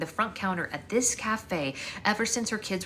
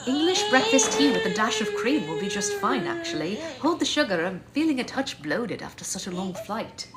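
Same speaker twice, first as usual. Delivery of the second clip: lightly voiced posh British prince; a hint of a British accent, airy and polite; 6 semitones higher, 4 dB louder than usual. Prince ordering tea from café owner, light British accent. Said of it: "He did have the accent down. It sounded British".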